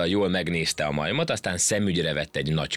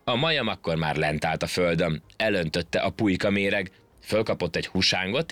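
plain reading voice

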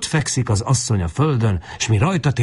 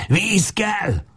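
high, strained cry; hoarse cry; 12 semitones higher than usual. A narrator's reading. Crazed, thirsty Lajos demanding water, hoarse cry. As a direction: cry it out hoarsely, pitch raised. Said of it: "kiáltja rekedten".

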